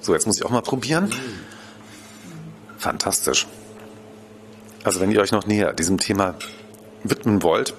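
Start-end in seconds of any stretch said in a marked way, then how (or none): 2.8-7.7 talking while still chewing; words round a bite of fish; mouth full. Real, not acted. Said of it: "ich darf ja nicht mit vollem Mund sprechen".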